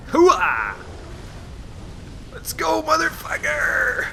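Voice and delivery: high, slow war shout; battle cry. Real, not acted. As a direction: roar it out as you charge. Battle cry while attacking.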